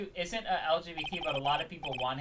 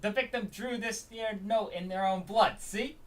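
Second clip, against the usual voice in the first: slow, higher, rough try at Brooklyn; attempted Brooklyn accent; 7 semitones higher, slower than usual. Detective presenting the victim's note, tentative Brooklyn accent. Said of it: "I need to get the broken accent".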